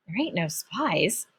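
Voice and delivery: quiet, slow, bewildered echo; boggled mumble; stunned echo. Acted. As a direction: repeat it to yourself in disbelief. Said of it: "my turn to be boggled".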